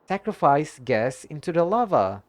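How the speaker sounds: ordinary talk, nothing marked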